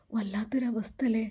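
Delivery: hushed whispered lament. Acted. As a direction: whisper it like a secret sorrow. Mother sighs over girl's plight.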